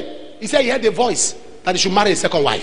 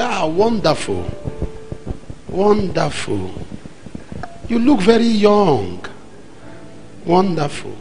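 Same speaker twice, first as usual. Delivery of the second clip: slow, drawn-out musing of a visitor; slow appraisal; 2 semitones lower, slower than usual. Retold or imitated. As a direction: stretch the words while sizing someone up. Visitor sizing up the young apostle.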